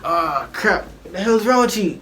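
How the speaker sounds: retold protest, rising high; squeaky voice. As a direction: imitate your younger squeaky self. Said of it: "I had my squeaky voice".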